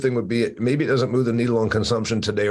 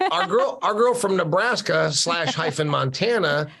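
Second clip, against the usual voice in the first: high-pitched teasing protest; mock indignation; 7 semitones higher than usual. Real, not acted. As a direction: needle her in a raised, incredulous pitch.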